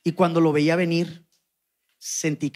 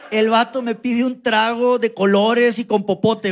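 usual speaking voice

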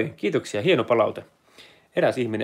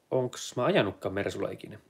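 ordinary delivery

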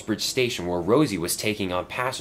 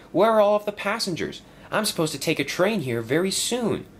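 higher, lively little engine; worried old engine asking Sir Topham Hatt, lightly raised engine voice; young engine voice; 11 semitones higher than usual. Acted. Stepney worried about repairs, asking his boss in a higher voice. Stepney calls after Diesel.

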